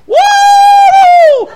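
loud, very high, long "woo"; imitating an ecstatic worshiper's holler; shouted whoop. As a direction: let out a piercing revival whoop. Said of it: "he was shouting, he was going".

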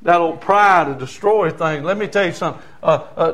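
ordinary talk

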